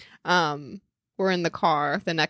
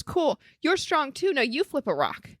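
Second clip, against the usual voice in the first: lightly voiced little boy, higher; a hint of a proud four-year-old; mother quoting her son at play, lightly childlike; imitating a child; 6 semitones higher than usual. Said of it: "He goes".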